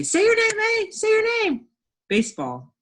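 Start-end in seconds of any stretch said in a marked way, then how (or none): 0.0-1.7 very high, loud squeaky character voice; sneaky E. Pretend. Sneaky E scaring the A, squeaky bossy voice. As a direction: pounce on it in a high-pitched demanding squeal. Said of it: "sneak in and scare that A and say".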